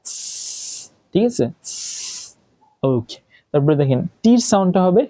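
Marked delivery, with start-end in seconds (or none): 0.1-0.9 স as a long, soft unvoiced "sss"; snake-like S. Shown as a demonstration. Teacher imitating a snake's sound, স as soft "sss". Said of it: "শব্দ করে ওরকম". 1.6-2.4 স as a soft, sustained hiss; snake-like S. Shown as a demonstration. Teacher repeating the snake sound, স as soft "sss". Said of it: "শব্দ করে ওরকম".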